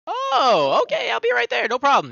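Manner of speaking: high, loud nervous eagerness; flustered eager reply. Acted. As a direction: blurt it out, eager to please. NPC summoned, nervous compliance.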